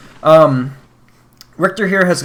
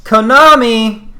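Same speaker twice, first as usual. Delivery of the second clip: mock-panicked drawl; 9 semitones higher, 8 dB louder, slower than usual. Loud slow comic exclamation.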